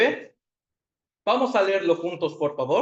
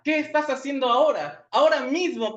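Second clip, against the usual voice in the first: higher, pressing challenge on "Qué" and "Ahora"; forceful question; 7 semitones higher than usual. Pretend. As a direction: press the question hard, pitched up. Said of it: "Dios nos pregunta con más fuerza".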